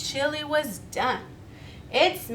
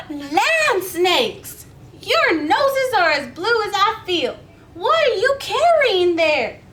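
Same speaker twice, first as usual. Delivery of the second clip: much higher, louder astonished voice; amazed exclamation; 7 semitones higher, 7 dB louder than usual. Acted. Miss Billy greeting shivering friends, astonished and loud. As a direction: cry out in surprise.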